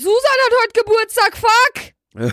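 loud, high sudden panic; blurting out in alarm; shocked outburst. Real, not acted. Suddenly remembers forgotten birthday.

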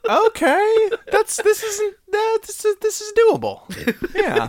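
excited. Real, not acted. Taking on an absurd challenge.

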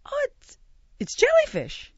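high-pitched, eye-popping mock alarm; retold shock. Diner discovers what the third dish is, retold shock. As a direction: squeak it out in stunned disbelief. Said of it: "my eyes, like, pop out of my head".

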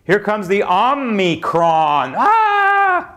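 slow, hammed-up cartoon villain announcement; cartoon laugh. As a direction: announce it like a cartoon monster, then a high yelp. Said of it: "Sounds like a damn cartoon character".

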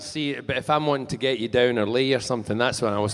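thick Scottish brogue; playful Scottish accent. Shown as a demonstration. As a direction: slip into broad Scots. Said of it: "I speak Scottish".

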